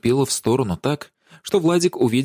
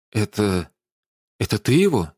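hesitant, faltering question; falter, as if afraid to finish asking; bewildered, frightened. A narrator's reading. Владимир suspects Даша of killing, bewildered and afraid. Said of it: "испуганно взглянул на Дашу и проговорил растерянно".

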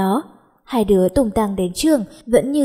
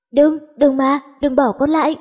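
nightmare scream; 5 semitones higher than usual. Acted. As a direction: beg them not to leave you, terrified. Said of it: "Nó hét lên trong cơn ác mộng". (hét lên sợ hãi trong cơn ác mộng)

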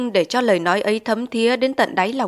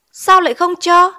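much higher and louder query; astonished question; 9 semitones higher, 6 dB louder than usual. A narrator's reading. Teacher hears landlady forbids studying, astonished question. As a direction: ask in raised-voice disbelief.

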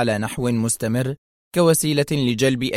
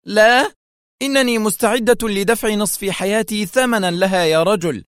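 high, impassioned outburst; exclaiming; 9 semitones higher, 3 dB louder than usual. A narrator's reading. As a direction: burst out eagerly, loudest on the first word. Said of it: "هتفت". (هتاف منفعل بنبرة عالية)

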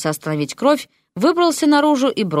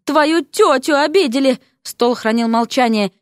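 indignant outcry; 7 semitones higher than usual. A narrator's reading. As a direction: draw it out in outraged offence. Offended lady demands action.

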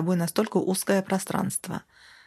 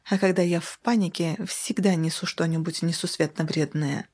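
plain reading voice

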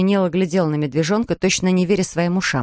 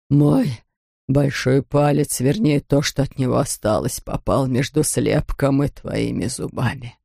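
low, measured, sinking voice; deep, weighty dentist voice; injured dentist, deliberate; 5 semitones lower, slower than usual. A narrator's reading. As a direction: spell out the grievance low and deliberately. Injured dentist confronting the bear.

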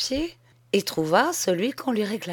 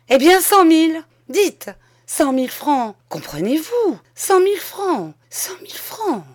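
pleading that climbs higher and louder; increasingly frantic, high-pitched begging; desperate bargaining; 7 semitones higher, 5 dB louder than usual. A narrator's reading. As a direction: raise the offer frantically, voice climbing. Desperate bribe offer to departing postman.

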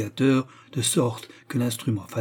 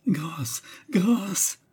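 higher, heartrending plea for mercy; cri déchirant; 8 semitones higher than usual. A narrator's reading. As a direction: plead in a high, anguished voice. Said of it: "cria-t-elle avec une voix déchirante". (supplication déchirante)

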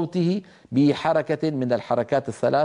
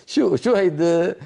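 mock disbelief; 3 semitones higher, 3 dB louder than usual. Real, not acted. Mocking the wrong reading.

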